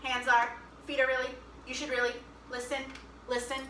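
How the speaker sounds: fast clipped-off prompt chant; speed round. Shown as a demonstration. Teacher runs the speed round of the song, fast chant. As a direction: rattle off the prompts quickly. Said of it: "Speed round".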